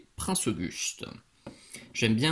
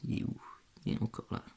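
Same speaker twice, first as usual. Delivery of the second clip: soft, breathy whisper while searching; whispering to self; 8 dB quieter, much faster than usual. Real, not acted. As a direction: mutter it under your breath, hushed. Hunting a stray hair on the mini.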